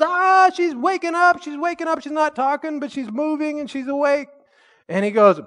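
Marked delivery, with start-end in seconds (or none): 0.0-4.3 much higher, breathless excited report; excited squeal. Retold or imitated. Hairstylist telling the pastor the woman is waking, high excited squeal.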